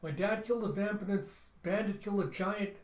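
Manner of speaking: put-on high, urgent child's plea; pleading child. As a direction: beg desperately for help. Child begs captain for help.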